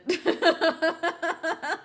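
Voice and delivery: a high, delighted laugh; real giddy laugh; high burst of laughter. Real, not acted. Laughs at Lando's title.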